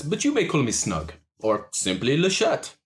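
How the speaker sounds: a hint of a smooth French charmer; French-accented orc. GM voices the orc Le Chat.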